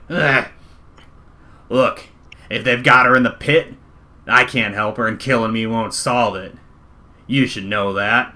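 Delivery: loud, blustering cornered thug; regaining his footing. Acted. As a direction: bargain forcefully while backed into a corner. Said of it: "tries to, like, regain his footing".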